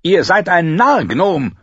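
a knight shouting an insult at the departing dwarf; shouting after him. Acted. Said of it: "schrie er Tyrion hinterher".

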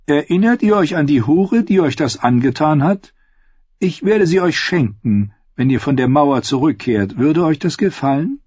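high, commanding noblewoman; lightly voiced scheming queen. A narrator's reading.

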